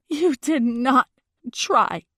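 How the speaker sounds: slow, loud, high rebuke; seething. A narrator's reading. Girlfriend rejecting his claim that he tried, seething. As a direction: space out each word in anger. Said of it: "I am seething".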